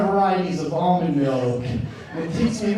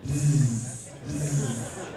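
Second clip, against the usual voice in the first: ordinary delivery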